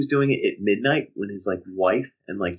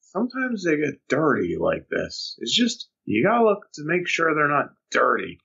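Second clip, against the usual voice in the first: lightly mocking, higher-pitched instructional voice; weird monotone man; 5 semitones higher than usual. Son imitates a dull video repairman, weird monotone voice. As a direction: drone like an amateur how-to presenter, pitched up. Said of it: "he has this weird monotone voice".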